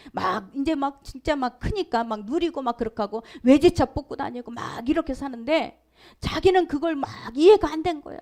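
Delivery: ordinary delivery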